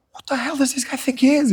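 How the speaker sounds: lightly hammed-up indignant girl; offended girl. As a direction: voice her hurt pride. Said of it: "And I was like".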